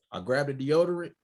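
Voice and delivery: normal talking voice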